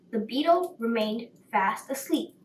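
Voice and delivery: ordinary delivery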